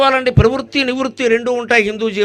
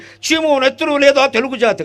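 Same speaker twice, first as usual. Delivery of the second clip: high, ringing theatrical hero's cry, much higher and a little louder; heroic stage dialogue; 7 semitones higher, 3 dB louder than usual. Retold or imitated. Discourse speaker quoting a patriotic drama line, theatrical declamation. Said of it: "Alluri Sitaramaraju ఉంటాయి".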